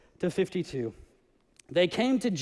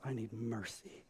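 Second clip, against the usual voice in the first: quiet plea; 8 semitones lower, 16 dB quieter than usual. Acted. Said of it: "knew there's one thing".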